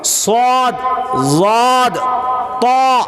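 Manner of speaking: "صاد، ضاد، طا" full-mouthed, thick consonants; heavy articulation. Shown as a demonstration. Teaching thick versus thin letters, heavy "صاد، ضاد، طا". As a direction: say "صاد، ضاد، طا" heavily, each held alone. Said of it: "ایک موٹا کر کے پڑھتے".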